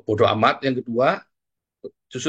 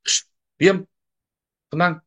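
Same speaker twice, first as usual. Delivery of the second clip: mock hushing; 8 semitones higher, 4 dB louder than usual. Demonstrates hushing someone at home.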